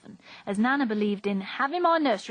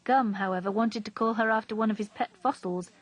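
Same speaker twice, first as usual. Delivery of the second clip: ordinary delivery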